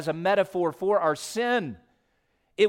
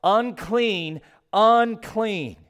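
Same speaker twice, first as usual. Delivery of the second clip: rising, louder cry of Unclean; a mournful cry of Unclean, each one higher and louder; leper crying out; 6 semitones higher, 5 dB louder, much slower than usual. Acted. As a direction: call out Unclean like an outcast leper warning others. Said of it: "he shall cover his upper lip and cry out".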